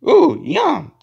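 high, loud pretend delight; delighted yum. Toy character at pretend breakfast, delighted. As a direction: squeal over pretend food.

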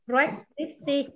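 preskripsi very slowly, puffing the p up front; slow demo. Shown as a demonstration. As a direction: start preskripsi with a breathy p, then rec. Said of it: "Bật hơi xong mới đến rec".

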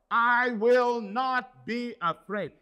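I will not be afraid, said slow and loud; loud, slow declaration. Shown as a demonstration. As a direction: proclaim it boldly. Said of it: "we have to say this".

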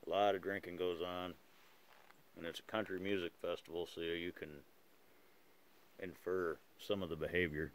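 talking around pipe stem; clenched teeth on pipe; pipe in mouth. Real, not acted. Seen 1: Pipe clenched in mouth through all labelled frames, held in hand elsewhere.

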